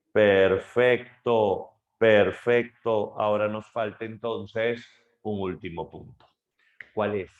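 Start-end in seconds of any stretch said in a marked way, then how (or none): 0.1-1.7 slow, loud approving perfecto; drawn-out praise. Real, not acted. Teacher praises student's answer, slow loud approval. 2.0-3.2 drawn-out praise. Real, not acted. Teacher approves again.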